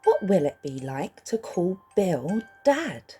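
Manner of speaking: plain reading voice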